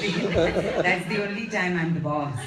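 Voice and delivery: laughing. Real, not acted. Producer laughing that she's only boss here. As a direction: play along with the joke, laughing. Joking about being the boss.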